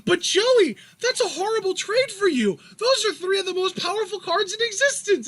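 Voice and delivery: high-pitched, earnest anime hero voice; Yugi. Acted. Yugi protesting Joey's bad trade, high earnest voice. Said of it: "Yugi's just like".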